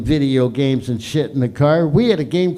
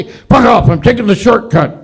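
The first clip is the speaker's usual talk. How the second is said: loud snapping dad voice; angry dad; 5 semitones higher, 7 dB louder than usual. Retold or imitated. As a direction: bark it back at the nagging wife. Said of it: "What would my dad say?".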